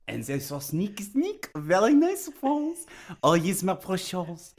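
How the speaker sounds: put-on Gollum with a thick French accent; hammy French Gollum, pleading and sly. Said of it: "say it in a French accent".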